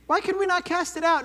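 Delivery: high, bewildered disciple asking; puzzled disciples. Acted. Disciples voiced asking Jesus.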